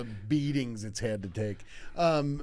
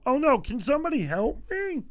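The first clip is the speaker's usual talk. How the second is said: high, put-on call for help; mock helpless teacher; 11 semitones higher, 3 dB louder than usual. Injured teacher calls down the hall, mock distressed cry. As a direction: plead in a comic high voice. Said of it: "we hear him go".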